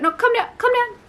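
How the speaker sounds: high, loud calming voice; mock-soothing high coax. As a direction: talk herself down, pitched high. Calming her own frantic self.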